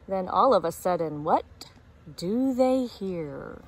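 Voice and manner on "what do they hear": plain reading voice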